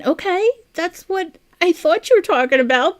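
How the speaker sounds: high, loud surprised burst. Real, not acted. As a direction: blurt it out in surprise. Surprised reaction to alien project.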